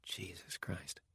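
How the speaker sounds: horrified whisper. Acted. Dying man whispers to himself in dread. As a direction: breathe the words in dread. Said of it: "he thought".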